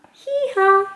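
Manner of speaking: high hee-haw; donkey bray. Shown as a demonstration. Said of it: "make some of these noises".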